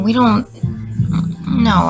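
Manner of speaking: ordinary talk, nothing marked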